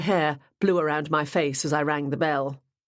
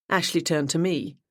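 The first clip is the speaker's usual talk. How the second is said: ordinary delivery, nothing marked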